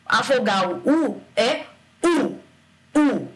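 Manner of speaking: "U" drawn out as a full strong vowel. Shown as a demonstration. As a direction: hold "U" round and strong.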